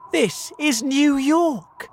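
high, loud, drawn-out announcement; excited shout. A narrator's reading. James tells his friends where they are, excited shout. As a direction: proclaim it slowly at a thrilled high pitch. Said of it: "shouted James excitedly".

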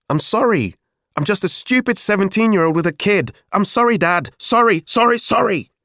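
high, loud, sarcastic outburst; angry shouting. A narrator's reading. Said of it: "Don't shout at me".

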